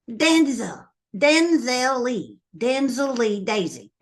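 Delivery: slow, proud trying-out of the name; savoring the name. Retold or imitated. Big sister trying out her brother's full name, slow and proud. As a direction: roll the name out slowly.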